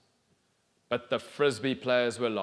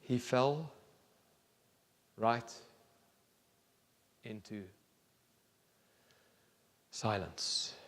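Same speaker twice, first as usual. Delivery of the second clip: softer and softer into silence; fading; 6 dB quieter, slower than usual. A narrator's reading. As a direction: let the voice fade away slowly.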